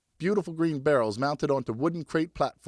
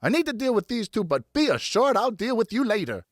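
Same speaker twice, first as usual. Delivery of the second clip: stern threat; 8 semitones higher than usual. Acted. Mother threatens sailors.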